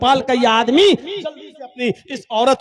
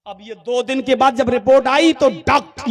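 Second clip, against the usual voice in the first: ordinary talk, nothing marked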